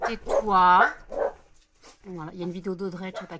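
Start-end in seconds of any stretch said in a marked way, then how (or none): 0.1-0.9 very loud yell to silence pets; sudden scolding shout. Real, not acted. Telling the pets to shut up, sudden loud shout. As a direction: break off and yell the order. Snaps at pets mid-sentence.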